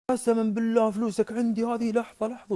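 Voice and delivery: high, eager, placating voice; fawning commander. Acted. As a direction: swear it insistently in a high pitch. Commander anxiously hands money back. (باستعطاف ولهفة وصوت عالي النبرة)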